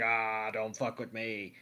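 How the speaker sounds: lightly voiced legendary beast, slow; a hint of a menacing beast. Suicune voiced threatening hunters.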